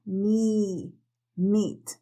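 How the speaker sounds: mi, meet with a long ee, lips spread; long smiling I. Shown as a demonstration. Teacher models the long smiling I sound. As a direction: smile and hold the ee in meet. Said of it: "Y sonríete y haz la I larga".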